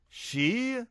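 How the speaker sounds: a sudden high shocked "She?"; screamed. A narrator's reading. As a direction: shriek it in disbelief. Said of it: "screamed Candy".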